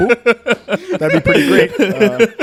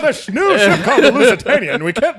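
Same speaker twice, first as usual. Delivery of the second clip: higher, chipper transatlantic newsreader; old radio announcer; 5 semitones higher than usual. Retold or imitated. Friends mocking old radio, chipper announcer voice. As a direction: read it like a cheery 1910s radio host. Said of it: "the radio voice".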